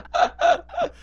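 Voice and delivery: laughing it out high. Real, not acted. Friend laughing at his own pun, high laugh. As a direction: crack up over the pun.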